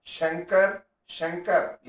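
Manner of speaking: శంకర్ with retroflex ష; wrong retroflex sh. Shown as a demonstration. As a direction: say శంకర్ as షంకర్, mockingly. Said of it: "ఏమిటి ఈ పిచ్చి భాష సంకరం". (తప్పు ష ఉచ్చారణ ఎగతాళిగా)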